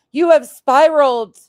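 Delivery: loud sneering mimic; mocking quote. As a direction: shout her words back mockingly. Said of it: "mocking her claim".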